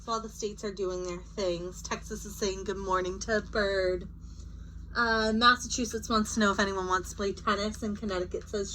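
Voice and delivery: plain reading voice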